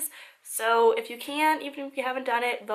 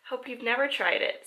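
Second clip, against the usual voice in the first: ordinary delivery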